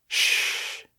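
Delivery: soft unvoiced hush; soothing shh. A narrator's reading. Emily to crying Chase, soft soothing hush. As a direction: hush him gently like a worried friend. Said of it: "Emily soothed".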